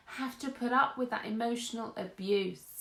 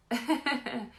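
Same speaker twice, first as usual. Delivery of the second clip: short laugh. Real, not acted. Laughs at herself.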